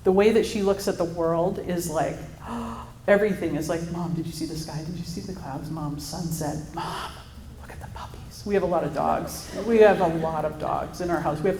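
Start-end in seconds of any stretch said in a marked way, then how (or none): 2.2-3.0 a soft breathless gasp of wonder; mimicked gasp of wonder. Mom imitating her daughter's amazed gasp. 3.9-8.4 put-on soft, hushed kid full of wonder; imitating her daughter. Mom quietly voicing her daughter pointing out the sky.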